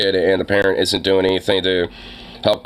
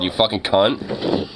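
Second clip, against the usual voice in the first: normal talking voice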